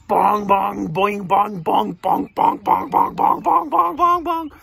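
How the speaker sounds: rapid, loud imitated bonks; rhythmic string of punchy bongs; bouncing-ball noises. Reader imitates balls hitting snake, loud repeated bonks.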